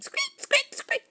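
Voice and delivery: very high squeaky squeak; gerbil squeak. Acted. Storyteller imitates the gerbil, very high squeaks. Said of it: "gerbil started squeaking".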